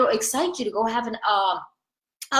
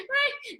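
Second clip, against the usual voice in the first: high shrieking laugh; 12 semitones higher, 8 dB quieter than usual. Real, not acted. Cracking up at her own joke.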